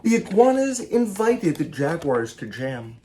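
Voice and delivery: lively picture-book read-aloud, each animal line acted; story reading. A narrator's reading. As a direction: read it to children with bounce and play. Author reads picture book aloud.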